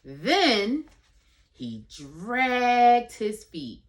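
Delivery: slow, drawn-out storybook reading; reading to a toddler. Acted. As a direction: stretch it out for a toddler. Reads picture book aloud.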